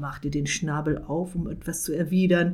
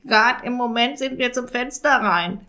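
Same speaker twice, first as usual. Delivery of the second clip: raspy raven voice; croaking raven; 5 semitones higher, 4 dB louder than usual. Acted. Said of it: "krächzte Jakob".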